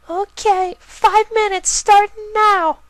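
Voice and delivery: very high filly voice, slow and deliberate; determined filly. Acted. Filly starting the dare, very high and slow. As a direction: count off the start boldly.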